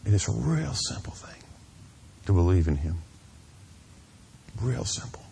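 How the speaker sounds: breathy half-whisper, leaning in close; hushed whisper. Real, not acted. Pastor urging the congregation to believe, whispered. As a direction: drop to a whisper to make it intimate. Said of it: "whispered, then plain, then whispered".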